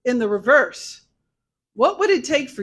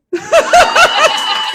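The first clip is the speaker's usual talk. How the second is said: loud burst of laughter; 13 semitones higher, 12 dB louder than usual. Real, not acted. Laughing at her own story.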